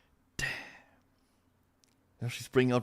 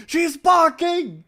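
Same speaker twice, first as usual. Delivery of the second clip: slow, high words through laughter; can barely get it out for laughing; 6 dB louder, slower than usual. Real, not acted. Streamer reacting to the barking clip, cracking up.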